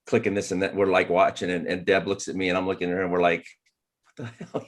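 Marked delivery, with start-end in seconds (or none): 4.0-4.7 breathless whispered disbelief; a hushed what-the-hell to your wife; stunned whisper. Retold or imitated. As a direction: mouth it under your breath, floored. Stunned couple watching Photoshop tutorial.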